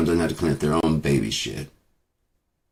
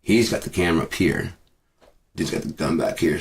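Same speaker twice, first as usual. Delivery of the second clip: usual speaking voice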